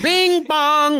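high, sing-out train chime; imitated train chime. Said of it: "which is literally the train".

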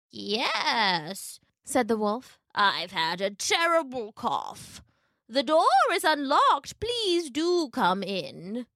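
slow, creaky fake grandmother; a wolf feigning a sick old lady; wolf posing as granny. Wolf tricking Little Red, faking a sick granny.

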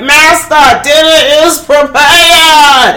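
high, slow, loud sung announcement; Magenta announcing. Retold or imitated. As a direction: ring out a theatrical servant's call. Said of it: "she's like".

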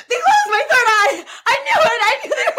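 high, loud laughing mock panic; laughing shout. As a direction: shout it in hammed-up alarm, laughing. Mock panic about chakras.

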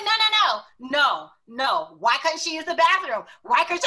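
loud, insistent mock outrage, pitched high; imitated livid boyfriend. Boyfriend challenging the clerk for her, imitated livid. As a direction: protest over and over in his voice, loudly. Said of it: "and he was livid".

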